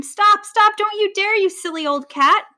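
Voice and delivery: high, insistent scolding cry; horrified screech. A narrator's reading. Vulture sailing after the lion, horrified screech. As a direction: screech to stop him. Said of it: "screeched the horrified vulture".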